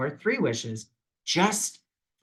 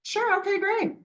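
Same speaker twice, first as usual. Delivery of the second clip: much higher, sing-song sure okay great; chirpy universe voice. Pretend. Universe gladly granting a Paris wish, in a high chirpy voice. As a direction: play the universe as a perky high-pitched helper. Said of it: "the universe was ... like".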